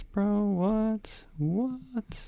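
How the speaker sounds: high, soft "What? What?"; high disbelief. Real, not acted. Slides show up blank.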